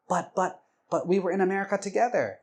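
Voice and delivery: high, hurried mock protest. Wife objecting to being told they differ, protesting. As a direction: stammer an objection, high and fast.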